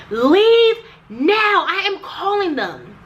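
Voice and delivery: imitating the receptionist yelling at her to leave; mock shouted order. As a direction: bark the order like the receptionist did.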